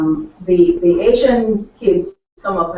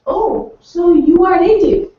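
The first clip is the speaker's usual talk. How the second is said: higher, louder and slow, imitating the parents' surprise; mock surprised parent; 9 semitones higher, 6 dB louder, slower than usual. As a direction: draw it out like a surprised visitor. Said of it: "they are like".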